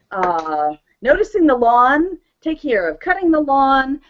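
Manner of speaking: ordinary talk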